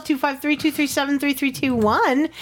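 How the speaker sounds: high, hyped host call-out; excited call-out. Real, not acted. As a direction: pitch it up and sell it. Host calls out phone number.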